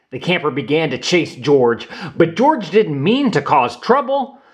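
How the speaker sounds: plain reading voice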